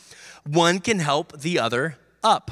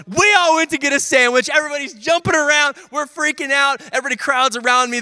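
much higher and louder, rushing with joy; jubilant retelling; 9 semitones higher, 5 dB louder than usual. Real, not acted. Preacher telling the team's celebration, jubilant and loud. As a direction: relive the win, bursting. Celebrating the tenth free throw.

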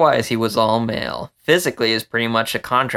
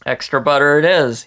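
brisk and punchy, a touch louder; dynamic; 5 semitones higher, 6 dB louder than usual. Acted. Vince choosing the popcorn, upbeat and punchy. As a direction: announce it with gusto. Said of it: "he said dynamically".

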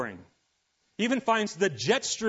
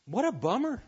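deflated sigh; 5 semitones higher than usual. Retold or imitated. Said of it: "Solomon looks at that and goes".

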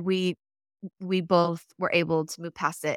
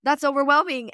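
high, overwhelmed gasp; high, rattled exclamation; mock flustered man; 7 semitones higher, 3 dB louder than usual. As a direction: play a flustered dad who is swamped. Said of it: "they're like".

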